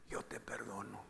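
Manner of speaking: low, near-whispered retelling; tender forgiveness. Retold or imitated. Dying wife forgiving her husband, hushed and tender. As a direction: drop to a hushed, low murmur. Wife's last words of forgiveness.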